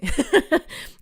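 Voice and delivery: a bright burst of laughter, rising; short rising laugh; high giggle. Real, not acted. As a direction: laugh at your own joke. Laughing at her own joke.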